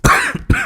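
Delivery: sharp real coughing fit; sudden cough. Real, not acted. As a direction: cough hard, caught off guard. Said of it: "And I'm choking now".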